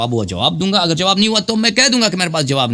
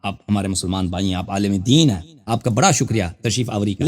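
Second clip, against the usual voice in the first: much lower and softer, gently placating; soft conciliatory; 9 semitones lower, 3 dB quieter than usual. Real, not acted. Host gently soothing the offended elderly cleric, soft and low. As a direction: speak low and soothing, smoothing it over.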